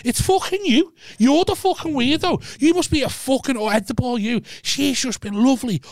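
outraged high-voiced retelling; excited rant. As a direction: pitch it way up in disbelief. Retold rant to mate.

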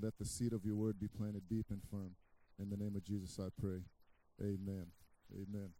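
ordinary talk

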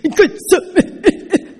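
high, gulping imitation sobs; mock hysterical crying. Shown as a demonstration. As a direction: try to talk through hammed-up uncontrollable crying. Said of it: "and it's hysterical".

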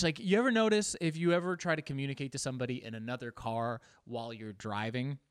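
usual speaking voice

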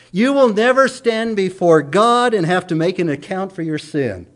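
usual speaking voice

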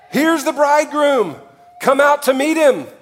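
high ringing call; midnight cry. Acted. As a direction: call it out like a herald.

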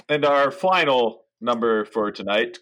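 ordinary talk, nothing marked

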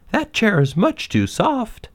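put-on high, slow storybook girl, displeased; high, slow, fussy character voice; Goldilocks, complaining. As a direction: draw out the complaint in a girl's voice.

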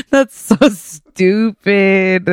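very slow, drawn out through laughter; amused disbelief. Real, not acted. Listener reacting to a corny joke, drawn-out amused disbelief. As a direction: drag the words out after laughing. Groaning at a bad joke's punchline.

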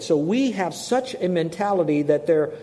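normal talking voice

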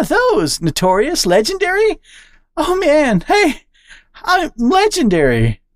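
high, delighted interruption; thrilled squirrel. Acted. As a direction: crow with pride at the word. Charlie reading synonyms excitedly.

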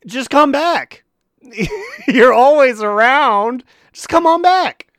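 high, loud calling out. Acted. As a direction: call out to him, coaxing. Calling out to absent raider.

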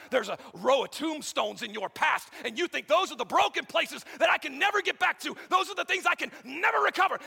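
loud, high, climbing sermon shout; full force preaching. Acted. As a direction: preach it out to the back row.

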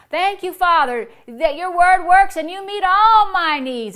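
loud, joyful thanks to God; demonstrated prayer of praise; praising. Shown as a demonstration. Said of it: "you see, you're praising".

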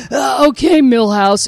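high, loud, hesitant dad voice; hesitant cartoon father. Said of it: "So he goes".

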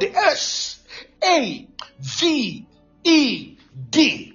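letter by letter, each one drawn out; spelled out slowly. Real, not acted. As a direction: spell it slowly and emphatically for the congregation. Spells the word for congregation.

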